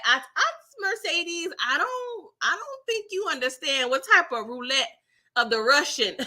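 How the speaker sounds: half-laughing plea, rising high; mock alarmed warning. Voicing herself warning Mercedes.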